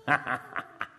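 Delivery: short, breathy burst of laughter; triumphant laugh. Acted. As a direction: laugh in disbelief at being spared. Exulting at being chosen.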